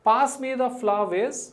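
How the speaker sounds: reading the example. Shown as a demonstration. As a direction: read the example out for the class. Teacher reads example sentence aloud. (తరగతికి వాక్యం నెమ్మదిగా చదవడం)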